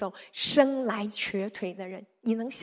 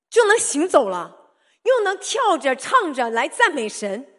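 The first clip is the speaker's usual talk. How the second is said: much louder, higher fervent sermon; full-force preaching; 5 semitones higher, 11 dB louder than usual. Acted. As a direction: drive it home to the congregation.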